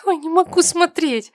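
put-on high-pitched tearful servant girl. A narrator's reading. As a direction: wail it high, unable to bear it. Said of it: "Заплакала какая-то служанка".

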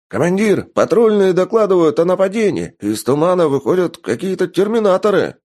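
high, loud alarmed report; urgent report. A narrator's reading. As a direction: report the attack breathlessly. Soldier reports attack.

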